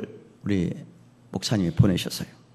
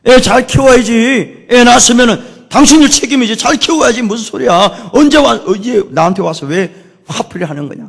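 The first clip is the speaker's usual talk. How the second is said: loud, raised-pitch mock scolding; angry retort; 11 semitones higher, 11 dB louder than usual. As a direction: hit back as if blamed unfairly. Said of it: "같이 막 분노를 일으키면서".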